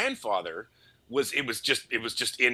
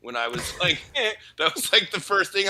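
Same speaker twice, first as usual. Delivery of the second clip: ordinary talk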